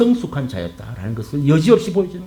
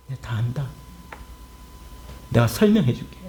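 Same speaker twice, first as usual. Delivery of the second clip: suddenly hushed, low and tender; low, quiet reassurance; reassuring God; 4 semitones lower, 7 dB quieter than usual. Acted. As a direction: drop right down to a soft, consoling promise. God's voice drops to reassure Jonah.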